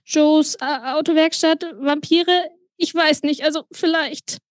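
high, flustered and halting; frightened, stumbling phone plea; nervous stammer. A narrator's reading. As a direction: blurt broken fragments in a panicky high voice. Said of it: "stotterte ich mit trockenem Mund".

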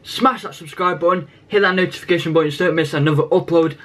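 energetic opening pitch to subscribers; YouTube intro. Acted. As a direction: greet the viewers with channel energy. Channel intro to viewers.

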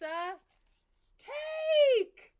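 very high, loud excited shout; big reveal. Acted.

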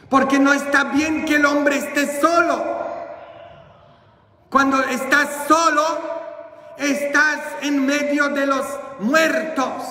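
high, ringing pulpit declaration; emphatic preaching. Real, not acted. Preacher warns his flock about being alone, emphatic preaching. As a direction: preach it high, with weighty pauses.

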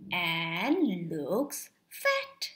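lingering low storyteller voice on "fat"; slow, drawn out. A narrator's reading. Reading the cactus swelling.

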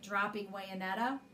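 ordinary delivery, nothing marked

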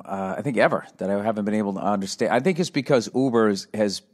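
ordinary talk

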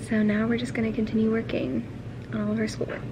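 library whisper, breathy and hushed. Real, not acted. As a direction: keep it to a whisper.

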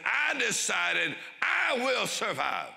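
strong and slow like a vow; determined declaration. Retold or imitated. Preacher voicing the camp survivor's vow, slow and ringing. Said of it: "he said".

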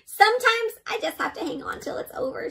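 lightly voiced, high-pitched storybook character; patient listener character. Character waiting out Gil's long story, lightly voiced high. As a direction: raise the pitch slightly theatrically for the character.

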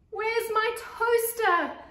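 very high, loud, drawn-out wail; anguished wail. Acted. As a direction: howl it in slow dismay. The worst thing of all.

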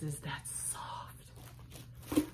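slow, breathless whisper. Real, not acted. Vlogger marvelling at the soft scarf, whispering slowly. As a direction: hush it out slowly as you feel the scarf. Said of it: "Why am I whispering?".

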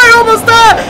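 ordinary talk, nothing marked

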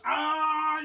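high, loud wail; high sustained "ah"; pained yell. A narrator's reading. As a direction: cry out as if squashed. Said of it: "yelled Haley".

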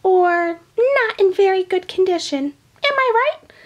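put-on high, squeaky old lady voice; Grandma puppet voice. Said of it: "Grandma, you are right".

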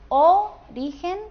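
"Origen" with the first O punched high and loud, drawn out; stress on first syllable. Shown as a demonstration. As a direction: hit the O of "Origen", slowly. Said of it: "¿Qué diríamos?". ("Origen" con acento en la primera sílaba, O alta y alargada)

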